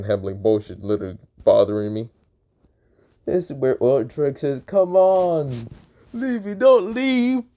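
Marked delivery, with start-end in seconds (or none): 4.7-7.4 high, drawn-out plea; mock pleading. Drake begs Libby not to go, high pleading. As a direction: beg in a high voice, stretching the words.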